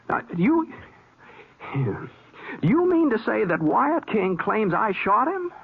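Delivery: can't believe what he hears; incredulous surprise. Acted. Shocked at false accusation.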